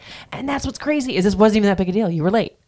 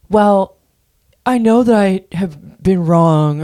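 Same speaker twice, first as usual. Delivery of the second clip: louder, slow, put-on solemn apology; mocking Sandoval; 3 semitones higher, 7 dB louder, slower than usual. Host mocking Sandoval's podcast apology, hammed-up solemnity. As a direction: ham up a fake heartfelt confession. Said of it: "He's sitting here in this podcast going".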